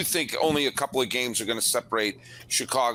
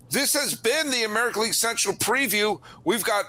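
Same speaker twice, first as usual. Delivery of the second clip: podcast host outro; 5 semitones higher than usual. Acted.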